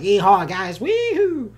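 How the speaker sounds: jokey Texas cowboy yeehaw, rising high at the end; hammed-up Texas whoop; mock Texas accent. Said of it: "I don't have, like, a Texas accent".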